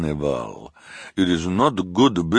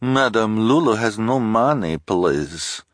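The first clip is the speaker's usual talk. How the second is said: put-on high, louder, pleading foreign accent; Madame Lulu's strange accent; 8 semitones higher, 5 dB louder than usual. A narrator's reading. Fortune-teller pleads poverty, in a strange accent. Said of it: "said in her strange accent".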